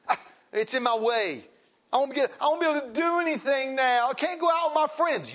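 high whiny mock complaint; mocking whiny mother. Preacher mocking a selfish young mother, high whine.

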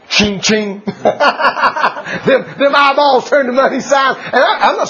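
high laughing joke, words through laughter. Real, not acted. Preacher teasing Eve about cake money, laughing. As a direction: crack up as you tease the money signs.

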